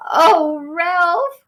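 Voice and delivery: high, loud anxious cry; mother mouse crying out. Acted. Mother mouse cries out to Ralph in alarm. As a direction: gasp it out like a frightened mother. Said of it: "cried his mother".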